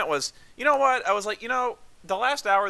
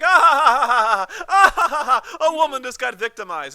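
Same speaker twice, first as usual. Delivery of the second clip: loud hammed-up laughter, higher, mocking the crowd; mock cackling audience; 6 semitones higher, 7 dB louder than usual. Mocking moviegoers who laughed at the assault, loud fake laughter. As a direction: cackle like the clueless audience, then the punchline.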